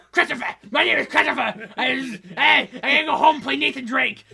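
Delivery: loud, high, laughing mock of Chris. Girlfriend mocking Chris heading home to play, loud. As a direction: shout it like a boyfriend bolting for his game. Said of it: "Chris's girlfriend's impression of him".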